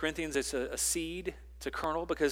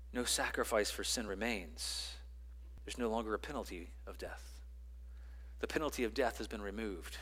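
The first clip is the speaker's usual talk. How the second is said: hushed pulpit reflection; quiet sermon; 3 semitones lower, 3 dB quieter than usual. Acted. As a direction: bring it down, gentle and earnest. Softer, slower sermon passage.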